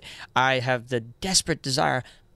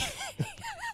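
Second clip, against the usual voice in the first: laughing; 13 dB quieter than usual. Real, not acted. Laugh at five-hour energy.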